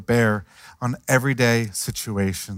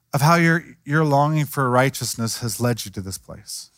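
ordinary delivery

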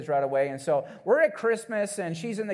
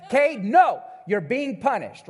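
louder, sharp scolding mother; mock mother telling off her son; stern mom; 6 semitones higher, 5 dB louder, slower than usual. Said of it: "she says".

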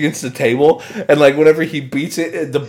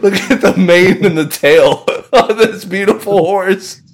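gushing host; 5 semitones higher, 4 dB louder than usual. Retold or imitated. Imitating the host admiring the butterfly, mock gush through laughter. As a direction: gush over the horse while cracking up. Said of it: "he's like".

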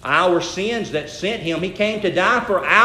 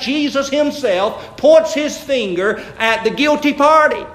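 emphatic sermon, pitched up and projected; forceful preaching; 4 semitones higher, 4 dB louder than usual. Real, not acted. Preacher tells congregation who the guilty party is, forceful. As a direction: drive the point home like a preacher.

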